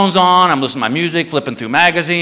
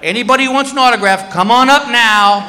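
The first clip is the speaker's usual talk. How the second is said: raised, higher announcing voice; addressing a whole line; 4 semitones higher than usual. Retold or imitated. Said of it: "he goes to this whole security line".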